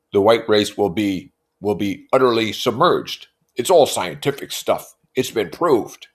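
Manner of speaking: loudly voiced Tom; Tom's voice. A narrator's reading. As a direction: lecture like a brute. Tom voiced in read novel.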